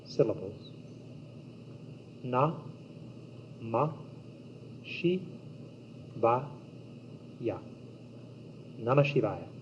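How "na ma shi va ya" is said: "Na, Ma, Shi, Va, Ya" one at a time, a pause after each; syllable by syllable. Shown as a demonstration. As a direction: separate "Na, Ma, Shi, Va, Ya" into five isolated syllables. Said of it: "the five sacred syllables".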